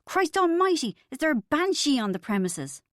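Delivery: put-on a light Irish accent, high indignant start; Irish accent complaint. A light Irish accent, pub regular complaining about the shrieking. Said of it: "complain in an Irish accent".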